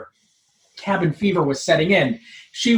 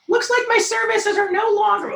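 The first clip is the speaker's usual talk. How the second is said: much higher, smug nerd voice; smug Urkel. Acted. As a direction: gloat like a smug nerd bargaining. Steve's line.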